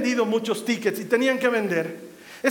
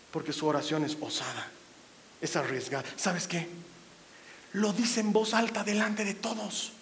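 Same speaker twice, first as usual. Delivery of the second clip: hushed; 6 semitones lower, 10 dB quieter than usual. Real, not acted. Sudden drop in voice.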